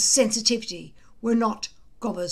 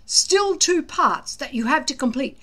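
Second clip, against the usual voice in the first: gruff lecture; 3 semitones higher, 8 dB louder than usual. A narrator's reading. Gobber lecturing the boys.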